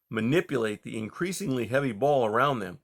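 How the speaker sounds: ordinary delivery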